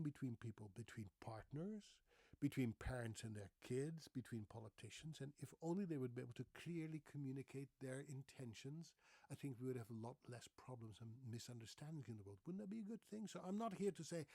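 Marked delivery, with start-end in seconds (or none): none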